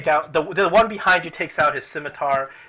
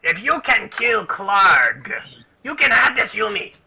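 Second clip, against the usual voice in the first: goblin voice; 5 semitones higher, 4 dB louder than usual. Acted. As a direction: drag out the offer like a sly goblin. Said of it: "he says".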